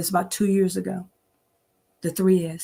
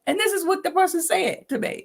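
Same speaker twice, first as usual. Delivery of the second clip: ordinary talk, nothing marked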